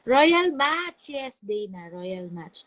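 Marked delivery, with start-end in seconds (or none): 0.1-1.1 louder, higher, with a rising call; happy recognition of the game; excited naming. Real, not acted. 1.9-2.6 much softer and lower, with a falling tune; low settled agreement; quiet confirming. Real, not acted.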